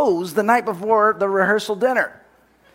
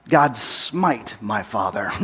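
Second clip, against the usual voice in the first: lower, slow mock angry prayer; 8 semitones lower, slower than usual. Angry son praying against his dad, low and slow. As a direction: growl a mock curse to God, slowly. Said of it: "it was an angry prayer".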